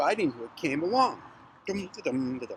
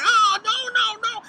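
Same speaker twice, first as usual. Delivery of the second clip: very high, loud wail; 6 dB louder than usual. Acted. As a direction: wail like a chased storybook child.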